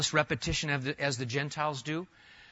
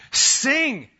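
loud, high call to sing; exhorting shout; 10 semitones higher, 8 dB louder than usual. Real, not acted. Preacher calling the congregation to sing, loud and high. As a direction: urge the whole congregation to sing out.

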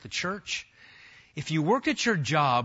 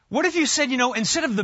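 excited burst; 8 semitones higher, 5 dB louder, much faster than usual. Real, not acted. Preacher rising exclaim.

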